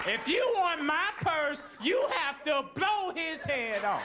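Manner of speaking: high-pitched goading yell; hammed-up wife egging on a robber. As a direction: yell a slow taunt from behind your man. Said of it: "you behind him yelling".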